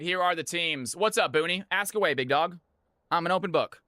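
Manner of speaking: ordinary talk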